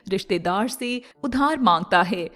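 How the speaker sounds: ordinary delivery, nothing marked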